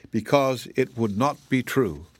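ordinary delivery, nothing marked

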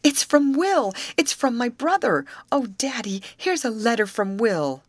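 a daughter thrilled her missing brother wrote; excited exclaim. A narrator's reading. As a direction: burst out with joy at the letter. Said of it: "she exclaimed".

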